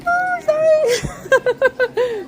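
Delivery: very high, drawn-out laughing squeal; squealed apology. Real, not acted. Apologizing by the dog, squealed and giggly.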